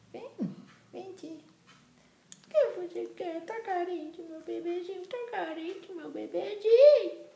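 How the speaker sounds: high, sing-song coo. Real, not acted. Cooing to baby.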